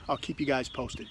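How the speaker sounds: ordinary delivery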